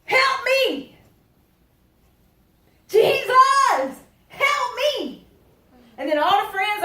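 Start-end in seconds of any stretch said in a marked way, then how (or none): 0.0-5.2 high, loud cries for help; desperate shout. Acted. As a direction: call out to Jesus over the crowd. Blind man crying out to Jesus.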